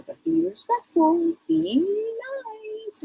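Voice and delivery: put-on adult gently reminding a little girl; gentle instruction. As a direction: coax a child kindly. Psychic gently instructing spirit.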